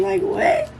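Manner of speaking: shocked. Retold or imitated. Shocked reaction.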